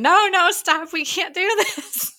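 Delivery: high-pitched retold yell, no real volume; mock yell. Hurley runs yelling to stop the fuse, high mock yell.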